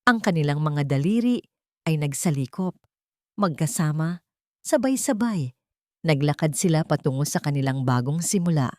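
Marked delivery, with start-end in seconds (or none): none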